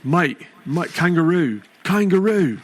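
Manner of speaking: hammed-up Aussie warm-up words; Australian accent. Shown as a demonstration. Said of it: "A couple words I gotta say before I get going".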